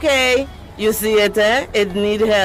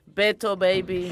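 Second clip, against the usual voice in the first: ordinary talk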